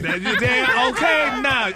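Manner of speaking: laughing through the words, stretched slow; real laughter, drawn out. Real, not acted. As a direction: crack up while saying it. Laughing at the fur jacket joke.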